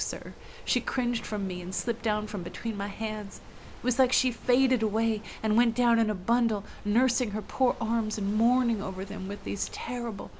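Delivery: usual speaking voice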